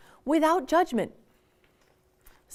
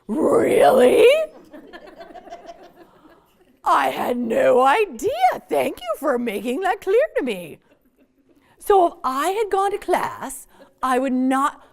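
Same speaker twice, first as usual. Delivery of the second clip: loud, high sarcastic teen voice, hamming up fake thanks; sarcastic mock gratitude; 5 semitones higher, 8 dB louder, slower than usual. Teen sarcastically thanking the parent for the lecture, hammed-up loud mock surprise. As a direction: overplay a teen's fake astonishment with a laugh. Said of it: "has gone".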